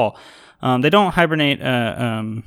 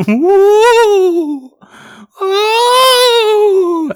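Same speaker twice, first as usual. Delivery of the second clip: very high loud ghostly wail; 8 dB louder than usual. Pretend. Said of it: "That's my ghost".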